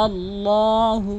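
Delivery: slow, drawn-out melodic tilawat; Quran recitation. Acted. Reciting to viewers as a slow melodic model. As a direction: chant each word long and even.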